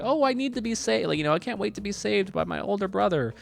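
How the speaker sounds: high-pitched damsel-in-distress parody; mock helpless Luigi. Luigi waiting for his brother's rescue, mock helpless. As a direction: imitate a helpless little brother. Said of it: "Luigi just sits around the whole movie like".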